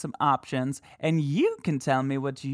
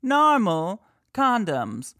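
mock EVP voice; 7 semitones higher, 4 dB louder, slower than usual.

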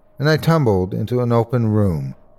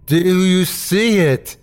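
high, slow, strained question; more insistent; 7 semitones higher, slower than usual. A narrator's reading. Injured Terry pressing the narrator, insistent. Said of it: "he said more loudly".

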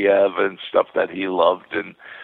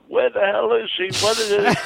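imitating a scolding nurse, voice pitched well higher; angry nurse; 10 semitones higher than usual. Nurse on the phone cursing him out, imitated higher pitched scolding. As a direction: mimic the nurse cursing him out, high and sharp. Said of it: "this nurse on the phone cursing me out".

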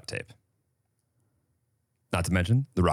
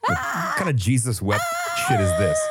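baffled outburst. Real, not acted. Baffled by the strange word.

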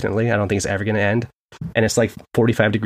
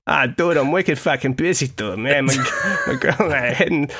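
hammed-up Boston accent, pitched higher; bad Boston accent; 3 semitones higher than usual. Shown as a demonstration. Podcaster imitates cousin talking about dinner with the wife, bad Boston accent. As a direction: do your cousin's thick Boston accent, badly. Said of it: "probably the worst Boston accent".

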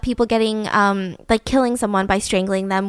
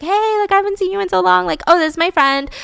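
chatty, higher-pitched mock friendliness; friendly lure; 7 semitones higher than usual. Barbara coaxing Margaret toward the car, imitated friendly lure. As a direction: retell a bubbly invitation to an old friend.

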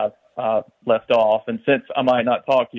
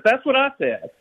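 put-on high childish voice, much higher; a small boy, smug, pitched way up; little Johnny; 13 semitones higher than usual. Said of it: "And little Johnny says".

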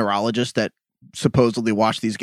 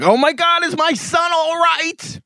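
much higher, drawn-out panicked mother; slower than usual. Retold or imitated. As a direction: play a fretting mom in the stands.

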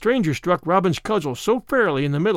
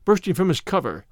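ordinary delivery, nothing marked

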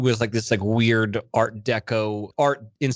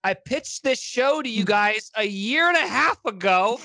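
mock sarcastic reply; 10 semitones higher than usual. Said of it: "And I said".

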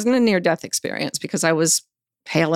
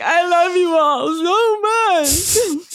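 high, drawn-out gush of love; 11 semitones higher, 4 dB louder, much slower than usual. Retold or imitated. Waking patient to the arriving nurses, gushing love. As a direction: gush it slowly, high and woozy, overflowing.

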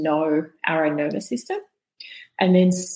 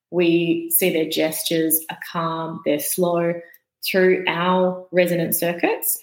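ordinary talk, nothing marked

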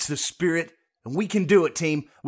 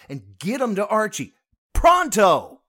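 loud order; 5 semitones higher, 7 dB louder than usual. Acted. Barking orders at the team.

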